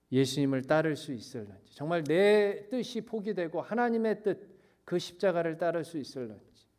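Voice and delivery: ordinary delivery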